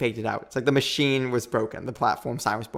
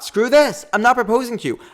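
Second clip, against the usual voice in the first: much higher and louder, fed up; exasperated outburst; 10 semitones higher, 6 dB louder than usual. Retold or imitated. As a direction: blurt it out like you're done with everything. Retelling her frustration to Garrett.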